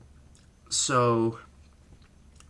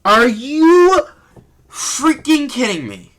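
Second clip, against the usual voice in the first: loud, high disbelieving outburst; outraged; 9 semitones higher, 6 dB louder, slower than usual. Real, not acted. Fan furious that the ref called for the bell, loud outburst. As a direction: blow up in disbelief.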